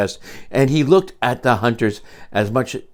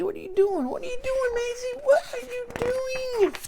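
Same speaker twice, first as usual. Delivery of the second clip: much higher, softer plea to a runaway bird; high teasing call to Maisie; mock high calling; 6 dB quieter than usual. Reader calls out to Maisie.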